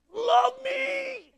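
high, far-off cartoonish shout; Jim Carrey plea. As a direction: belt it out like a hammy clown. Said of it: "He's doing the Jim Carrey thing".

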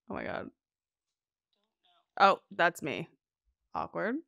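quiet, embarrassed under-breath; embarrassed muttering. Real, not acted. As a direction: mumble in awkward shock. Embarrassed realizing it's her.

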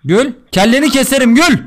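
loud, high shouted command; mock threat. Streamer ordering partner to laugh, mock-angry shout. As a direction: yell it as a tyrannical joke threat.

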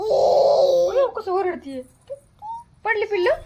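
sudden high, loud alarmed cry; startled cry. Real, not acted. Baby falls, mother alarmed.

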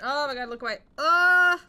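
panicked scream at something scary; frightened shriek. Real, not acted. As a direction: yelp in real fright. Scared by game.

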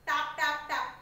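loud, spaced tap tap tap; tapping sound imitation. Shown as a demonstration. Teacher showing pupils the tapping sound, loud and even. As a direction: knock the word out like tapping on a shell. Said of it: "sound like tapping".

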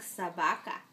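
ordinary delivery

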